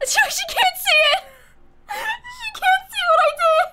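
squealing with laughter. Real, not acted. Gleeful about her prank donation.

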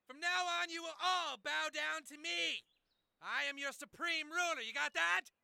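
very high, loud shouted command; power-mad high-pitched bellow; tyrant's proclamation. Acted. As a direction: shriek your orders at the crowd. Gunman declares himself supreme ruler.

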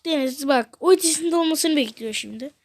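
usual speaking voice

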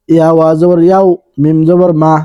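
ordinary talk, nothing marked

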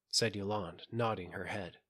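plain reading voice

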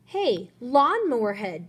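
loud, slow, drawn-out taunt; taunting nickname. Acted. Joey taunts Simon with a nickname, slow and loud. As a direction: stretch the insult to get a rise.

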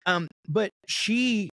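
usual speaking voice

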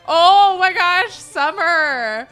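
very loud, high, drawn-out exclamation; mock-outraged cry at a daughter; exasperated yell. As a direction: blast it out in exasperation. Acted quarrel of mom and daughter.

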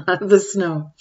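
plain reading voice

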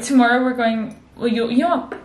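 ordinary talk

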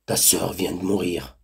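a bird of ill omen stating it bluntly, low; blunt vulture. A narrator's reading. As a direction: vulture tells the boy his sister died, low and curt. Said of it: "annonça tout net".

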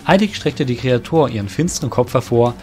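normal talking voice

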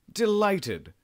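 an eager gentleman accepting a tour, delighted; delighted exclamation. Acted. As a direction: exclaim it with real pleasure. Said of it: "Sir Jeremy exclaimed".

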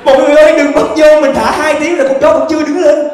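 high, loud, fast mock yell; mock shouting warning. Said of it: "Thằng chó à, đây dụ tao". (la lớn cảnh báo mọi người)

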